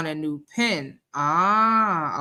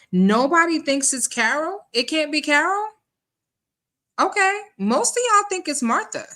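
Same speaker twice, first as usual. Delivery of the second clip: high, loud disbelief; surprised; 11 semitones higher, 4 dB louder than usual. Real, not acted. Host reacts to viewers' votes on Carol and Martha, surprised. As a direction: pitch way up, questioning the result in surprise.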